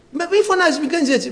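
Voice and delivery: high-pitched acted plea to stop; imitated remonstrating. Rescuer to the crying allergic man, acted high remonstrance.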